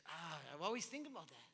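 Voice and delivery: ordinary talk, nothing marked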